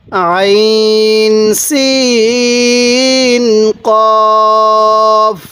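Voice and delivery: আইন সিন held very long, কফ short and firm; elongated recitation. Shown as a demonstration. Teacher reciting for viewers, each letter very slowly drawn out. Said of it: "সিনের মধ্যে চার আলিফ".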